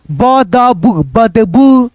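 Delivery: बदबू said slowly with a long clear ऊ; stressing the ऊ. Shown as a demonstration. Teacher stressing the vowel sound for students. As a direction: lean on the ऊ in बदबू. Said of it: "वहाँ पे ऊ की आवाज आप निकाल दो".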